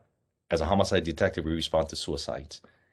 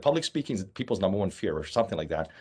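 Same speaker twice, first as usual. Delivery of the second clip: normal talking voice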